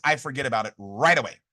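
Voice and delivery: ordinary talk, nothing marked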